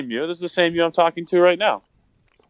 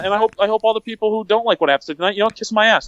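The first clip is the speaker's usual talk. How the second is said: ranting; 7 semitones higher than usual. Real, not acted. Ranting at listeners.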